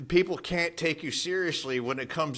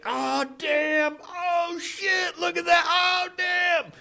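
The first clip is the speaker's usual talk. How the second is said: much higher, loud excited yelling; hyped bystander shouting; 3 dB louder, much slower than usual. Retold or imitated. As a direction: yell like a guy filming a street fight. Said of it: "clips on World Star Hip Hop".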